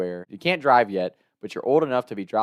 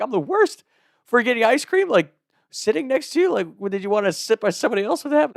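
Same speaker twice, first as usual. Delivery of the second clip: high, incredulous questioning; indignant disbelief; 13 semitones higher than usual. Retold or imitated. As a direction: fire back baffled questions in a high voice. Acting out bewildered quarrel reply.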